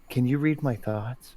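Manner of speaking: softly projected thought; Lois Lane. Acted. Player tests suspected telepath with a thought, softly spoken. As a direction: think it at him, hushed. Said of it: "Lois Lane style".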